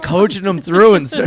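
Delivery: ordinary talk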